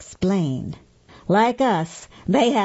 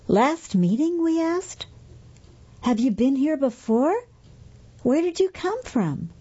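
puzzled questions; 6 semitones higher than usual. Acted. Humans questioning beings, puzzled.

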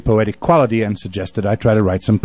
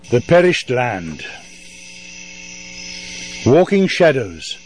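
poem recital; 2 semitones higher, 3 dB quieter than usual. Acted. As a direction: let each line hang in the night air.

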